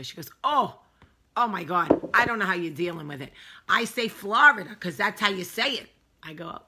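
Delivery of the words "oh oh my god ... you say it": put-on loud, appalled Northern accent. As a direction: imitate a Northern woman aghast at a pronunciation. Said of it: "I like to do a northern accent".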